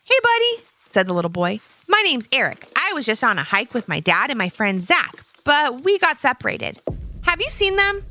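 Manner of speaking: put-on high, eager little-boy voice; little boy Eric. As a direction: chirp it like a friendly young kid. Child voice of Eric.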